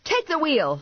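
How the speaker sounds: high, urgent command; yelling. A narrator's reading. Driver yelling to passenger while chasing, urgent yelling. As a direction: yell it out, pitch way up. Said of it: "I yelled to Vinny".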